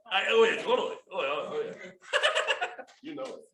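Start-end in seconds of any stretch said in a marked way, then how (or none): 1.9-3.0 laugh. Real, not acted. Laughing with the group.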